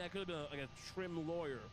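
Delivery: normal talking voice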